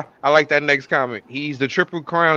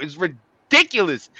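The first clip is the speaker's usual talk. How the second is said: ordinary delivery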